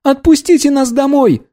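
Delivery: very high pleading cry; desperate demand. Acted.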